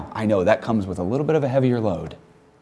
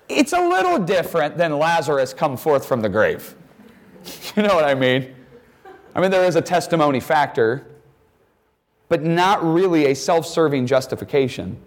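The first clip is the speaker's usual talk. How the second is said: ordinary talk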